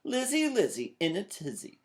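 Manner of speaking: high sing-song jeer; hollered taunt. A narrator's reading. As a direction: call it back over the shoulder. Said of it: "Sister hollered back".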